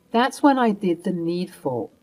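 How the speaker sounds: plain reading voice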